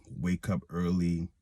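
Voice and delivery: ordinary talk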